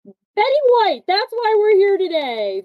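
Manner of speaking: high, loud show opener; excited host intro. Acted. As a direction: kick off the episode with energy. Opening announcement of show.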